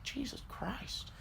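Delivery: slow mock-stunned whisper. Mover looks down at tiny customer, retold stunned whisper. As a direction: whisper it slowly in disbelief.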